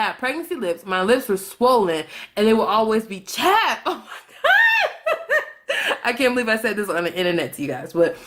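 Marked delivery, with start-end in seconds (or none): 3.2-6.0 high squealing laugh through the words; helpless high-pitched laugh. Real, not acted. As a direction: crack up, real embarrassed laughter. Embarrassed at her own remark.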